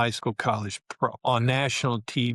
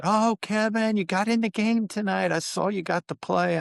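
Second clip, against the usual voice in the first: excited parents; 10 semitones higher than usual. Retold or imitated. Parents on phone with rookie son, overjoyed. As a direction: gush like a proud mom.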